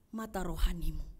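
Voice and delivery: ordinary talk, nothing marked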